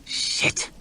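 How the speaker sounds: unvoiced hiss under the breath; whispered hissed curse. A narrator's reading. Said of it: "hissed Logan".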